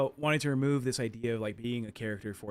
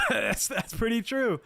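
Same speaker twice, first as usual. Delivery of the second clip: laughing through the words, high and loud; cracking up at the joke; 12 semitones higher, 6 dB louder, slower than usual. Real, not acted. Cohost cracking up at the Roxy joke, laughing.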